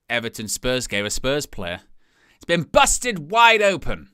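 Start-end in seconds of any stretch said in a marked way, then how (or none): 2.4-4.1 slow, hammed-up American wrestling-announcer accent, pitched higher; playful American accent, dragged slow. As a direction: drawl it out in a mock American accent. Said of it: "That was an American accent".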